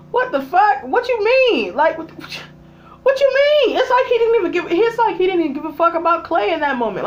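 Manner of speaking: high, loud, stretched-out disbelief; can't believe what she just saw; incredulous outrage. Real, not acted. Baffled at Clay's dad ignoring his son, high and loud.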